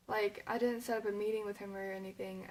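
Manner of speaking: usual speaking voice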